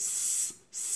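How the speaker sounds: স as a light, sustained hiss; thin S sound. Shown as a demonstration. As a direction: hold a plain thin স with no vowel. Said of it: "এভাবে".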